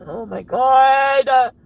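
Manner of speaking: loud, very drawn-out wail; exasperated outburst. Real, not acted. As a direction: stretch it into an exasperated cry. Overwhelmed outburst during angry rant.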